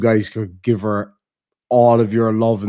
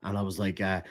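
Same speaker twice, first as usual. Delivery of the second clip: ordinary delivery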